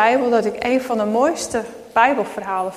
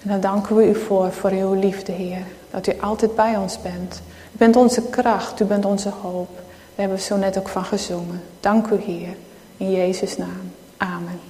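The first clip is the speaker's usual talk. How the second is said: prayer; 2 semitones lower than usual. Acted. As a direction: pray it gently, ending the prayer. Closing prayer before the sermon.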